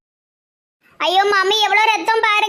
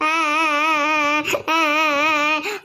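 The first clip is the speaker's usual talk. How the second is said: long drawn-out wailing sobs, softer; 5 semitones lower, 4 dB quieter, much slower than usual. Acted. Girl wailing over her injured sweetheart, long sobs.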